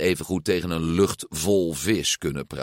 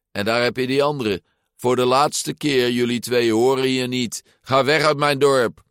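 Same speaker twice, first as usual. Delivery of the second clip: raised, higher bullying tone; angry scolding; 5 semitones higher, 3 dB louder than usual. A narrator's reading. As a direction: scold them, building to a loud order. Bully orders the boys away. (boos uitvarend)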